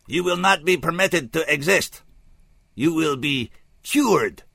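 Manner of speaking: loud, measured, formal alien voice; grave alien emissary. Acted. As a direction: pronounce doom in a stilted alien accent.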